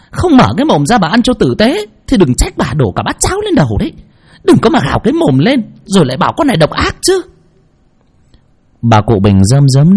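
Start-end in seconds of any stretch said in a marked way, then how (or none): 0.1-7.2 much higher, fast, venomous threats; sneering at the helpless old woman; spiteful scolding. A narrator's reading. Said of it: "hằn học". (giọng hằn học, mắng nhiếc nhanh)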